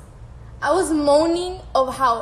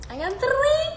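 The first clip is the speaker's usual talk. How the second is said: much higher, sing-song; high playful; 12 semitones higher than usual. Shown as a demonstration. Showing viewers her dimples, in a high playful voice. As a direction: chirp it up high. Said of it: "You see it?".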